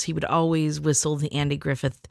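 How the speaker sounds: ordinary talk, nothing marked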